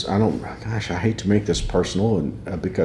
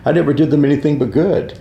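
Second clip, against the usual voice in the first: laughing; 6 semitones higher, faster than usual. Retold or imitated. Laughs while protesting innocence.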